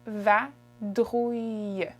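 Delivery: vadrouille stretched out slowly, syllable by syllable; very slow model word. Shown as a demonstration. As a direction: draw out vadrouille for learners.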